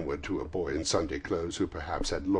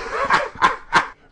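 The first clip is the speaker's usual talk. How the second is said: high, loud gleeful cackle; splendid laugh; 10 dB louder than usual. A narrator's reading. Scrooge out of practice for years, laughing splendidly. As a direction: burst out in a big joyous laugh. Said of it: "it was a splendid laugh".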